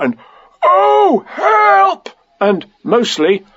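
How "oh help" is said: high, loud cry for help; cry from inside jar. A narrator's reading. Pooh calling from inside the jar, distressed cry. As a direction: howl for help as if trapped. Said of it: "he said inside the jar".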